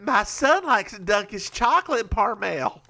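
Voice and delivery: high Southern mom testimonial; hammed-up Southern commercial voice; Southern accent. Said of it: "with a Southern accent like that".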